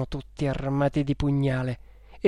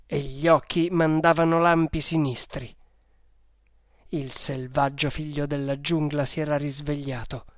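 ordinary delivery

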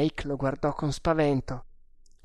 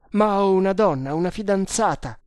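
loud, raised cry of desperation; desperate plea; 5 semitones higher, 6 dB louder than usual. Acted. Prisoner pleading for his fiancée, desperate. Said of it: "con un accento disperato".

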